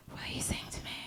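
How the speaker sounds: whispered, very soft and quiet; hushed creepy whisper. Retold or imitated. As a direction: breathe it out softly like a creepy cabbie. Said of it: "very softly and very quietly goes".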